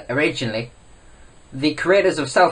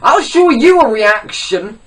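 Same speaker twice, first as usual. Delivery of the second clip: loud, high, drawn-out threat; hammed-up yell, stretched out; mock outburst; 7 semitones higher, 8 dB louder, slower than usual. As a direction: explode slowly in comic indignation. Mock outburst at face cam fans.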